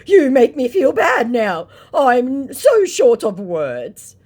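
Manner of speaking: high, slow whiny put-on voice; mocking the sulking scammer. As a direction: whine it like a wounded scammer. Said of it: "he says".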